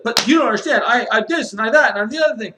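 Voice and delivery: high, loud mock whine, rushed at the end; whiny wife. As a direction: imitate the wife protesting in a whiny rush. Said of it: "and she's like".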